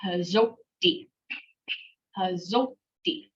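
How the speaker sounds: slow "hazoti" with a hold before the last syllable; careful model of "hazoti", doubled consonant held; held double consonant. Shown as a demonstration. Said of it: "We hold it".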